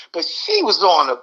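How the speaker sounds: usual speaking voice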